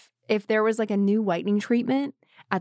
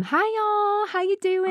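high-pitched imitation of a Southern mom; sweet singsong Southern phone greeting; Southern accent; 13 semitones higher than usual. Mom greeting daughter on the phone, Southern accent. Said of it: "don't have a Southern accent anymore".

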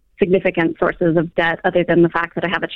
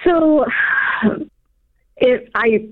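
crying; 5 semitones higher, much slower than usual. Real, not acted. Caller tearing up.

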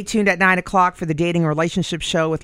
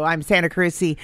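normal talking voice

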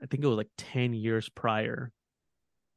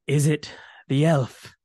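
gasping dwarf; 3 semitones higher, 4 dB louder than usual. A narrator's reading. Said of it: "Bruner gasped".

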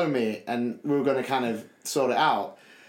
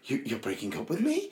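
stunned; 7 dB quieter than usual. Retold or imitated.

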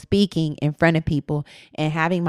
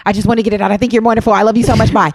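louder, rushed blurt with a racing heart; nervous rush of words, a touch louder; 4 semitones higher, 6 dB louder, much faster than usual. Shown as a demonstration. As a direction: gush it all out in one breath, heart racing. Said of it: "my heart will be racing and I'll be like".

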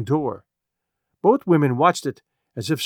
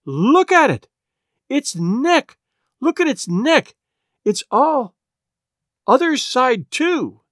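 high, urgent exclamations; horrified cry; 9 semitones higher, 4 dB louder, slower than usual. A narrator's reading. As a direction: cry out in broken bursts of alarm. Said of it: "cried Missus Hale".

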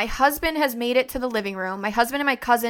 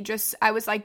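normal talking voice

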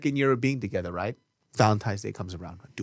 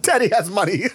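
high mock-indulgent squeal; comic high-pitched spoiling of a child; hammed-up doting dad; 8 semitones higher, 4 dB louder than usual. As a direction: play a pushover father, laughing. Skit of doting father giving in.

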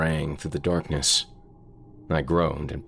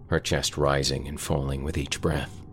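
plain reading voice

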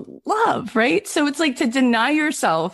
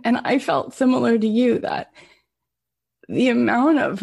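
usual speaking voice

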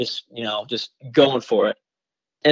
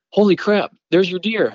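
excited exclamation; 8 semitones higher, 5 dB louder than usual. Retold or imitated. Dog handler to hunter, spotting the deer: excited. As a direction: pitch jumps high, louder, a startled find. Tracker spots the wounded deer.

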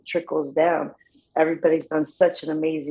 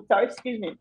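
ordinary talk

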